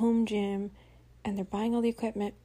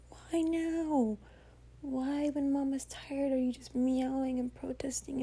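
high, drawn-out mock whine; mock-exasperated pet owner, pitched up; exasperated pleading; 5 semitones higher, slower than usual. Acted exasperated plea to her cat.

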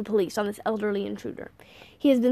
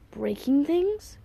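slow, higher questioning echo; odd, wondering tone; 5 semitones higher, much slower than usual. A narrator's reading. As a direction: repeat it slowly as if struck. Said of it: "sounding odd".

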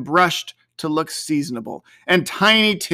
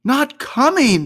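higher, slowed, crestfallen father; dismayed; 9 semitones higher, 4 dB louder than usual. A narrator's reading. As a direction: let his spirits drop. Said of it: "with a sudden declension in his high spirits".

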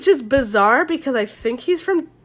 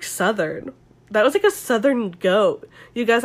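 usual speaking voice

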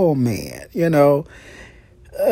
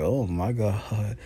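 soft swooning sigh; 6 semitones lower, 9 dB quieter, slower than usual. Retold or imitated. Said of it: "I was like".

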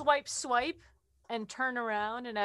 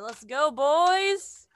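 rallying cry; 5 semitones higher, 11 dB louder, much slower than usual. Real, not acted. Rallying the party to attack.

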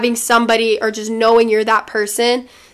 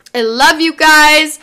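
affectionate; 5 semitones higher, 5 dB louder, slower than usual. Real, not acted. Affectionate sign-off.

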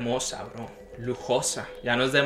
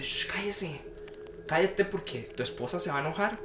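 much softer, hushed and urgent; hushed warning; 3 semitones higher, 9 dB quieter than usual. Retold or imitated. Best friend hushing him at the party, in a soft urgent hush. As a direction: hush him before the wife hears.

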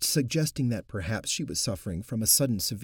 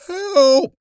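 much higher, far louder desperate scream; final scream; 16 dB louder than usual. Acted. Swelling woman's last cry to the crowd, desperate scream. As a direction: scream it at full force.

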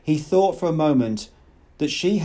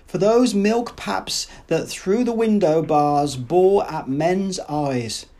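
slow, rolling, higher declamation of verse; thundering poetic recital; 5 semitones higher, slower than usual. A narrator's reading. The Savage recites Shakespeare at Lenina, slow thundering verse. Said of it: "The singing, thundering, magical words".

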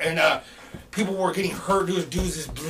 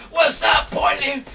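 high, loud concert greeting; stage shout to crowd; 10 semitones higher, 6 dB louder, slower than usual. Retold or imitated. Rapper greeting the crowd on stage, loud holler. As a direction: holler it out to the whole venue.

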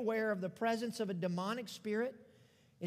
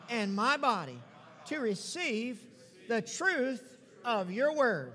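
bold declaration. Acted. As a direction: proclaim each line for the church to repeat. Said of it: "let's boldly declare".

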